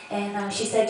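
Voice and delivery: normal talking voice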